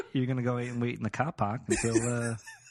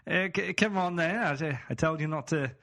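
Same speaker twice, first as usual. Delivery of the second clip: higher mock-exasperated Aussie scolding; scolding Australian boyfriend; 5 semitones higher than usual. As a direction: scold your girlfriend in an Aussie accent. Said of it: "I'm gonna be like".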